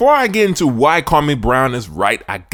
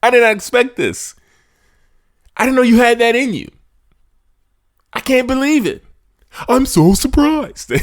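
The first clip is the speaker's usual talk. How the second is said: higher, hammed-up astonishment; mock surprise; 8 semitones higher than usual. Host imitates his own shock at Kwame speaking, hammed-up surprise. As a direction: act stunned with raised pitch, a bit playful. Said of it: "I was like".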